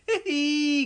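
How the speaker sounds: high tee-hee giggle; sneaky giggle. A narrator's reading. Norman after sprinkling the stinks bottle, sneaky giggle. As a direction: giggle to yourself, very pleased. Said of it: "giggled Norman".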